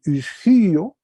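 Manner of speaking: isgio said loud with rising tune; teacher voices isgio clearly; Greek verb modeled. Shown as a demonstration. Teacher demonstrates Greek verb to class. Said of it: "el verbo ser fuerte".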